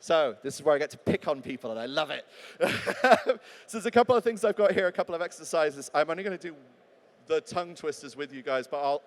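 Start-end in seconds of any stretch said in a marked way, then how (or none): 2.3-3.6 bright burst of laughter; gleeful laugh. Real, not acted. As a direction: laugh with delight.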